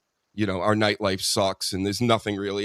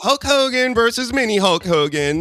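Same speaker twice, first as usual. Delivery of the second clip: put-on high, loud kid-with-toys voice; playing with toys like a kid; childish Tony Khan; 12 semitones higher, 5 dB louder than usual. Imitating the booker playing with his toys, high and loud. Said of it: "It's him going".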